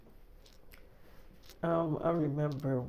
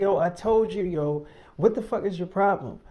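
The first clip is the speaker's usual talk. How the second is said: louder, higher, furious; loud angry outburst; shouting; 5 semitones higher, 7 dB louder than usual. Retold or imitated. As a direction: blow up at her. Door kicked, shouting match.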